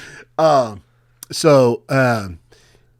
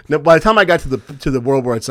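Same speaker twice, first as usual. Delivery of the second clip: ordinary talk, nothing marked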